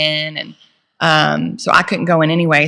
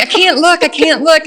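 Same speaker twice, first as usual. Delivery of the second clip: high, loud squeal of can't look; shrill-free frantic high plea, eyes shut; imitating her own panic, high; panicked refusal; 10 semitones higher, 4 dB louder than usual. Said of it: "And I'm like".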